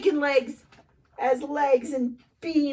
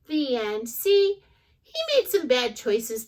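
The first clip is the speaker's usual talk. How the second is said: ordinary delivery, nothing marked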